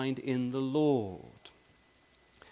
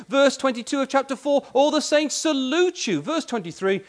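ever higher and louder, hammering each verse; climbing pulpit delivery, louder with each verse; rising preaching; 6 semitones higher, 6 dB louder than usual. Acted. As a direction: build the list like a fiery preacher. Builds verse list to climax.

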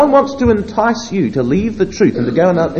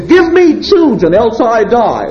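higher, louder desperate demand; imitating a desperate plea; 3 semitones higher, 9 dB louder, slower than usual. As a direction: cry it out to Jacob. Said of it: "she turns to Jacob and says".

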